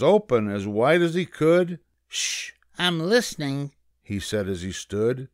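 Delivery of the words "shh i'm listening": high-pitched rabbit shushing; intent little rabbit, listening hard; hushing rabbit. A narrator's reading. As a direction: hush them in a raised rabbit voice. Rabbit hushes, straining to listen.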